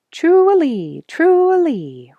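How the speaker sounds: high, loud sung bluebird whistle; bird call. A narrator's reading. Bluebird sings his greeting call to Bobby. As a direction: trill it like a bluebird's song.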